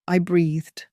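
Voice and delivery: plain reading voice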